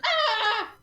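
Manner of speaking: high startled yelp with a laugh in it. Acted. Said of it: "Tito looks at him and goes".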